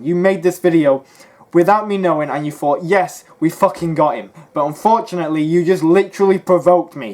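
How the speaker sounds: loud, heated callout; furious loud defence; angry rant. Real, not acted.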